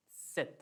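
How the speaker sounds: सेत opened with a quick t before the s; German Z letter name. Shown as a demonstration. Teacher repeating German Z for students, ts onset. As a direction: pronounce सेत as "tset". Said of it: "आधा त और से और त".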